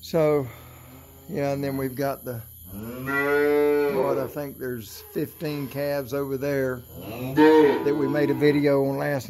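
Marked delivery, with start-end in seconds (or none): none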